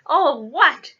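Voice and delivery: outraged booming echo; thundered. A narrator's reading. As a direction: thunder it back in disbelief. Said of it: "Hagrid thundered".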